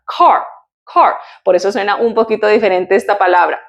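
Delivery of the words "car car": "Car" with an A from an O position; rounded car. Shown as a demonstration. Teacher repeating the rounded "car". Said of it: "saco un sonido de A".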